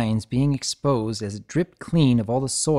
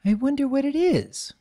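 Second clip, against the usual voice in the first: trembling uncertainty; 14 semitones higher than usual. A narrator's reading. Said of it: "trembling in a breath of uncertainty".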